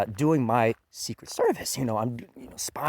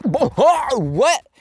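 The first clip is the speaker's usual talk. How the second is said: retold shock; 13 semitones higher, 7 dB louder, much slower than usual. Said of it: "I was like".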